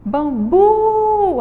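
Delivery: Bambu with a high, drawn-out "bu"; stress on last syllable. Shown as a demonstration. Teacher helps pupils identify the tonic syllable, stretched "bu" in Bambu. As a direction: hold the final "bu" of Bambu long and high. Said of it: "a gente vai identificar a sílaba tônica".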